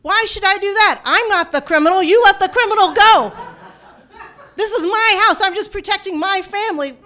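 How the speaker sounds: high, loud, outraged protest; indignant shouted retort, pitched well up; yelling back. Retold or imitated. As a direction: act out a defiant father yelling at police. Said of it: "he yells back".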